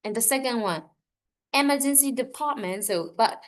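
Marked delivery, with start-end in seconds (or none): 1.5-2.9 "emergency" hit high at the start, "department" lighter; teacher stressing "emergency" for the class. Shown as a demonstration. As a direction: lean hard on "emergency", let "department" fall away. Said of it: "We have to stress emergency department".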